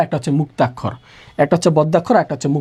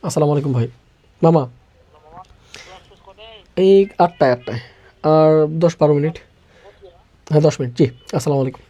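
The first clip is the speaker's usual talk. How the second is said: phone call aside; 4 semitones lower, faster than usual. Real, not acted. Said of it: "ক্লাসের মাঝখানে phone আসাটা".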